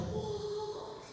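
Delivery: soft, high-pitched drawn-out whoa; mock martial-arts whoa. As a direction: play a tiny kung-fu dodge noise. Acts out Jackie Chan dodge.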